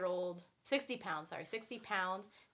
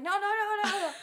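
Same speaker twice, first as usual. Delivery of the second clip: loud, high run of no's; exasperated no; 13 semitones higher, 10 dB louder than usual. Retold or imitated. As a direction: cut him off with repeated no's. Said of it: "I'm like".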